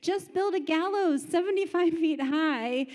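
a hammed-up exasperated spouse; sarcastic wife. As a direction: play the fed-up wife urging murder, half laughing. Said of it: "his wife, who sounds lovely".

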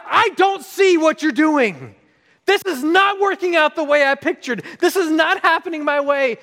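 high, loud, stretched complaint; worked-up protest at the top of the voice; exasperated outcry. Retold or imitated. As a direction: yell your frustration up at God. Acted complaint to God.

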